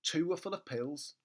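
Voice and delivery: ordinary delivery, nothing marked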